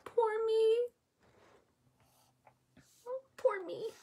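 high, soft forlorn wail; small, high-pitched self-pitying whimper. A narrator's reading. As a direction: wail it softly, lost and scared. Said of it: "he wails".

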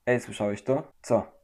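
ordinary talk, nothing marked

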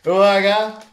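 loud, high count to four; countdown call. Real, not acted. Parent counting down for viewers to shout, loud. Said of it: "na trzy, cztery krzyczycie".

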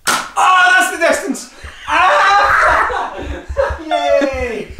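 laughing shout. Real, not acted. Shot at close range, laughing.

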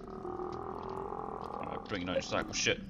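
very low growled challenge; deep tough-guy taunt. Pretend. Streamer taunting the game monster in a deep voice. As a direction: drop into a monster voice.

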